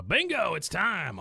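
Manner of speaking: high, lively mock-Peggy exclamation; excited announcement. Peggy telling Hank it's lovemaking time, excited. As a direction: announce it brightly, pitched well up.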